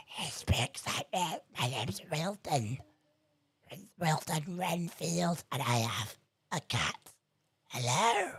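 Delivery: a hint of a quirky game NPC. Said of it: "a certain N P C".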